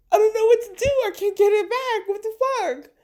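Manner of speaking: distraught high voice. Retold or imitated. You telling God your integrity is gone, high wail. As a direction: wail it like a hurt child. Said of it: "And you're just like".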